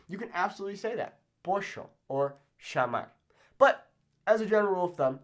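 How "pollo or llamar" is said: "Pollo", "llamar" as po-sho, sha-mar in Argentine accent. Shown as a demonstration. Teacher allows the regional option, Argentine accent. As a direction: Argentine Spanish sh for double L. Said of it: "a particular accent like that".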